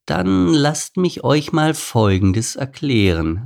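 condescending baboon, slow and meaningful; strange undertone. A narrator's reading. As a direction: draw it out with an odd hidden edge. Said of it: "mit einem seltsamen Unterton".

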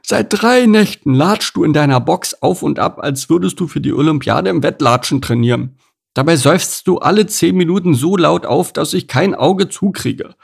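high, complaining pig character; grumpy pig. A narrator's reading. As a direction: grouse loudly about a restless stablemate.

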